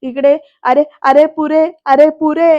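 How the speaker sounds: retold frantic cry of enough, enough; imitated yelling. Mai yelling at the uncle to stop, imitated loud plea. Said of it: "माई सुद्धा ओरडत असतात".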